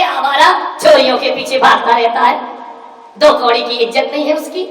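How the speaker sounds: loud contemptuous rant; loud, disdainful daughter; loud scorn. Retold or imitated. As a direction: sneer at the brother-in-law. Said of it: "अरे बोले".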